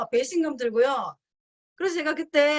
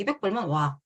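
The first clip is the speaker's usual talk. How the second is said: a scornful repeat of the younger husband's jab; mocking condescension; 5 semitones lower than usual. Wife's younger husband sneering at her offer, mock scorn. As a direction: toss it off dismissively, imitating him. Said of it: "이렇게 얘기를 하는 거예요".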